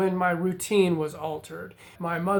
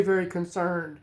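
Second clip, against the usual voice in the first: ordinary delivery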